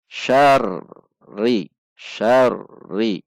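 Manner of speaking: "Syarri" with a pressed, doubled r; "Syarri" with a held rr; doubled r stressed. Shown as a demonstration. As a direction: lean on the tasydid r in "Syarri". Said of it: "Ada tekanan".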